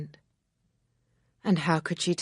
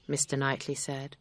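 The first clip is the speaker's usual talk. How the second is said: plain reading voice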